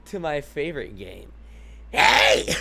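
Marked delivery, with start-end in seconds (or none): 1.9-2.5 high, loud raspy squawk; screechy cartoon mushroom voice; Toad. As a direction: do a rough, badly practised mascot shout. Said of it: "I gotta work on my Toad voice".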